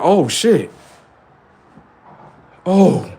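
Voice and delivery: hammed-up surprise at a fighter; mock startled. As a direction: play the bully caught off guard. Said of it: "they look at you like".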